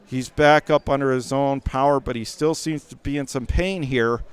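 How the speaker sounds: ordinary talk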